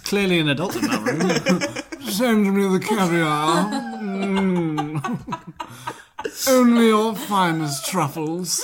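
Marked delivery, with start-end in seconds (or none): 2.1-8.6 slow, grand mock order; posh demand. The runaway child ordering room service, slow and grand. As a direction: order it slowly like a spoiled aristocrat. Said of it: "So maybe he'd been like".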